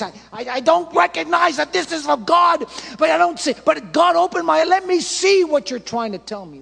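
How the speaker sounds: shouted, high, pleading cry to God; desperate shouting prayer. Acted. Preacher acting a broken believer crying out to God, shouting. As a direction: cry out to the Lord as if everything is falling apart.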